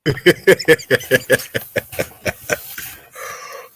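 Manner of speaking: breathy burst of laughter; unvoiced wheezing laugh. Real, not acted. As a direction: crack up at your friend. Co-host cracks up.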